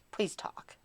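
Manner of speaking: softly whispered begging; whispered plea. Retold or imitated. Streamer whispering her old plea to chat, softly. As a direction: breathe it as a tiny plea. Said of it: "crying for people to please talk".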